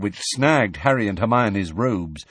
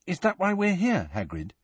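put-on high, light girlish question; inquiring; 10 semitones higher, 3 dB quieter than usual. A narrator's reading. Hermione asks Hagrid why they came, inquiring. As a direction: probe gently, dropping on the name.

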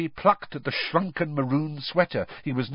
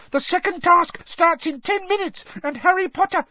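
frantic high-pitched elf; very high, agitated elf voice; Dobby; 4 dB louder than usual. A narrator's reading. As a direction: squeak an urgent warning. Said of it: "squeaked Dobby".

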